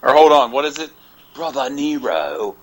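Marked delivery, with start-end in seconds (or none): 1.3-2.5 slow, softer British-southern drawl, trying it out; quieter Matt Hardy voice. Retold or imitated. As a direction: test the accent under your breath. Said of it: "mix in the British accent with the southern twang".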